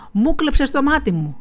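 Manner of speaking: shouting beggar. A narrator's reading. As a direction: yell the accusation at the merchant. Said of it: "φώναξε στον έμπορο". (φωνή ζητιάνου που κατηγορεί δυνατά)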